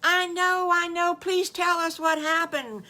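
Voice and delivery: high, loud, impatient plea; urgent high-pitched begging; excited begging. Acted. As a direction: plead eagerly like a child who can't wait. Wiggly begging to hear news.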